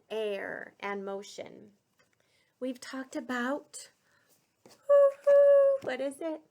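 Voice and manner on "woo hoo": high, loud woo-hoo of a train whistle; mock train whistle. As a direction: toot it like a toy train for the kids. Train whistle while naming trains.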